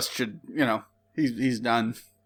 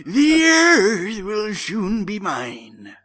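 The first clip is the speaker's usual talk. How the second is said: slow, higher, louder villain gloat; 6 semitones higher, 4 dB louder, much slower than usual. Acted. Reading Chaos's line to friends, slow and gloating. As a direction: savor every word like a madman. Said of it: "Chaos gloats madly".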